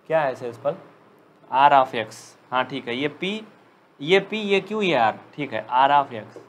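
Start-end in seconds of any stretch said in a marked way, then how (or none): none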